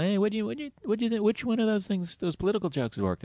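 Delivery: lightly imitating an eager host; high-pitched imitation. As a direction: voice jumps up high, quizzing. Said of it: "he's like".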